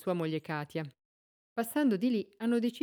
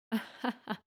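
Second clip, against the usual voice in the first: a sharp cackle of laughter; cackling laugh; 5 semitones higher than usual. A narrator's reading. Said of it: "Sghignazza la moglie del fornaio".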